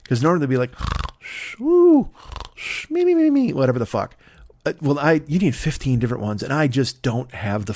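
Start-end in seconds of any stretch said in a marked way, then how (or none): 1.2-3.5 high whistling snore noises; mock snore with a whistle; cartoon snoring of a sleeping family; snoring imitation. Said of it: "I won't even do the snoring".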